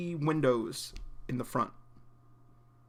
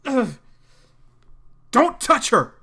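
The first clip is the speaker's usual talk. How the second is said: much higher and louder, furious yell; angry shout; 11 semitones higher, 13 dB louder, slower than usual. Acted. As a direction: bark it as if protecting her. Zeke protecting Serafina from John.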